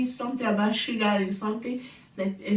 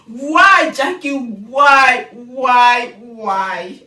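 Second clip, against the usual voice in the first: loud exasperated plea, louder; 10 dB louder, much slower than usual. Real, not acted. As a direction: cry out why at Jackie. Pleading at the TV.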